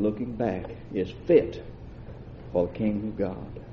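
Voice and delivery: low, measured Bible reading; solemn reading of Luke; scripture reading. Acted. Reads scripture in lowered voice.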